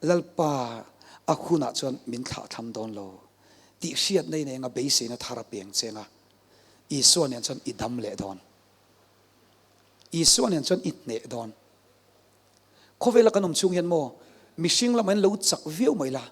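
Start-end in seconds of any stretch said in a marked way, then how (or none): none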